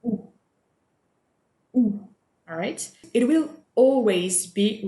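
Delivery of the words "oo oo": "oo" said as a rounded French ou, lips pushed forward; French ou sound. Shown as a demonstration. Teacher modelling "oo" for students, rounded and clear. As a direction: model "oo" slowly for the class. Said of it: "it makes the sound".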